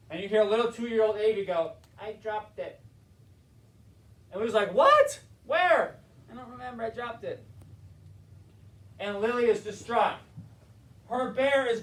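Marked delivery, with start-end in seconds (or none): 2.0-2.8 put-on small, soft toddler voice; a little kid admitting it quietly; two-year-old's voice. Toddler confessing to losing bear. 4.7-6.0 much higher, louder startled cry; shocked outburst. Retold or imitated. Family reacting to lost bear. 6.3-7.4 put-on small, soft toddler reply. Toddler answering about bear.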